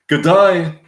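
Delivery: high Australian greeting; Paul Hogan. Acted. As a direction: greet in a broad Australian accent. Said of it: "Paul Hogan with the Australian accent".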